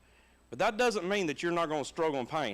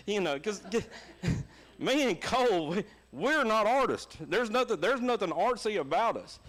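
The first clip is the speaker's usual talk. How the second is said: laughing; 7 semitones higher than usual. Real, not acted. Laughing at son's art class.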